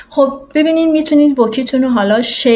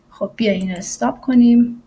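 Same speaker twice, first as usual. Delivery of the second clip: ordinary delivery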